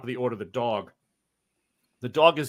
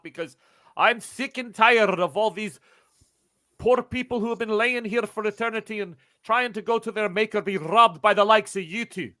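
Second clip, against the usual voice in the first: high, raised scolding voice; indignant gunman; 8 semitones higher, 3 dB louder than usual. Acted. Gunman scolds the suspected grave robbers, indignant. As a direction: berate them as grave robbers.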